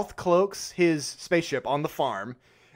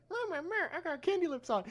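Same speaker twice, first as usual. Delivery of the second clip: much higher, softer squeaky mumble; squeaky goofy mumble; mock sheriff with candy lips; 12 semitones higher, 9 dB quieter than usual. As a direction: talk through fake candy lips. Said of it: "He's like".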